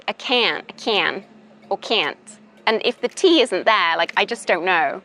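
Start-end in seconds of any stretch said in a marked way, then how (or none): 0.1-2.4 imitated American accent on "can" and "can't"; American "can't" with the vowel of "can". Shown as a demonstration. Teacher showing both words sound alike, American accent. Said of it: "in American".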